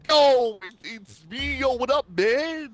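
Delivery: very high, loud New York greeting; New York guy. Retold or imitated. Guy on the phone greeting a friend in a New York voice. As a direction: imitate a guy saying yo on the phone. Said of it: "he was like".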